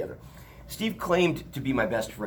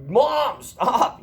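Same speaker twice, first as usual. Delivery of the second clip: high, loud cry of stop; shouting at mom; 12 semitones higher, 10 dB louder than usual. Retold or imitated. Driver yelling at his swearing mother, high and loud. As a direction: yell it at her over the phone. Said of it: "I'm like".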